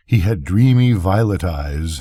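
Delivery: plain reading voice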